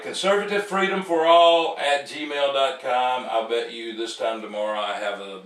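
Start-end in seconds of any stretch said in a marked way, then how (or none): 0.0-3.3 loud, higher, emphatic announcement; raised, insistent read-out of the email address; getting it right. Real, not acted. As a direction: belt out the address to nail it this time. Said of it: "get this right".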